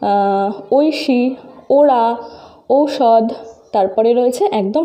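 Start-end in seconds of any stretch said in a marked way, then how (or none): 0.0-3.2 slow. Shown as a demonstration. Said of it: "দেখো".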